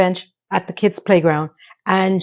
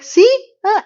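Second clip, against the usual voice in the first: high squealed see; excited see; 3 dB louder than usual. Retold or imitated. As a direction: shriek it up high, showing off. Laney shows off on ottoman.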